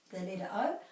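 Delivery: ordinary delivery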